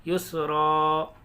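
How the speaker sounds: युसरा with the final "raa" held long; long open alif closing युसरा; stretched ending. Shown as a demonstration. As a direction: draw out the last vowel of युसरा, no n. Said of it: "फिर खींचेंगे उसको".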